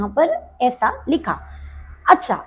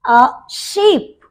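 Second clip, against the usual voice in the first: slow demo; 2 semitones lower, much slower than usual. Shown as a demonstration. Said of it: "जैसे कि".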